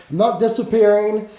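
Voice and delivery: ordinary talk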